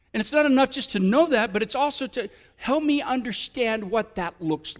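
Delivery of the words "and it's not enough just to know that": ordinary talk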